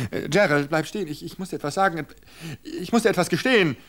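high, frantic pleading; desperate stalling. Acted. Wife stalling her attacker, high frantic pleading.

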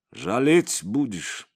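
usual speaking voice

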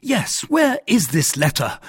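high, loud echo of the question; indignant cry. A narrator's reading. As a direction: throw the question back loudly, falling at the end. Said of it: "cried D'Artagnan".

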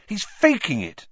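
high, heated accusation; indignant outburst, pitched high; furious. A narrator's reading. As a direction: accuse angrily, voice jumping up. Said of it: "said Harry furiously".

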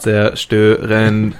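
slow drawn-out robot word; old speech synthesizer. Pretend.

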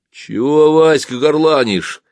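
stern. Acted. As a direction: pull up a noisy comrade sternly. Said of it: "строго спросил мой конвоир". (строгий окрик)

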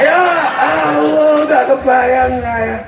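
slow, drawn-out despairing ya Allah; overwhelmed groan. Retold or imitated. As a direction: stretch it like a dad drowning in chores. Overwhelmed by the children's mess.

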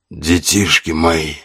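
slow sepulchral rasp; grim reaper. A narrator's reading. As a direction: croak it from beyond the grave. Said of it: "прохрипело существо с замогильным голосом".

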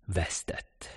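unvoiced near-whisper; hushed defeat. A narrator's reading. Narrator as the fugitive realises he failed, hushed.

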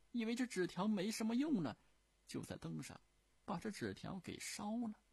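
高亢、活泼的说笑话腔调; 提高嗓门，绘声绘色讲故事; 李莲英讲笑话. Acted. (高调说书腔，讲傻儿子笑话)

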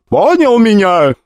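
high, loud and slow demand; loud threat. Acted. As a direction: bark the question down at him. Goddess threatens the rich kid.

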